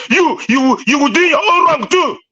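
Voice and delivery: ordinary talk, nothing marked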